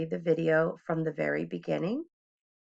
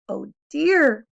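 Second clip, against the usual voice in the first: high, loud mock sigh; cat's mock dismay; 8 semitones higher, 9 dB louder than usual. A narrator's reading. Cat pretends sorrow at the boy, high. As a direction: sing out a pretend disappointment. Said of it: "said the cat".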